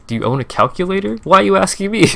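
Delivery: high-pitched, exasperated retort; aggressive. Retold or imitated. Streamer answering a random price-check message, aggressive. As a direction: read back your aggressive reply, pitch climbing. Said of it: "I sound pretty aggressive in the response".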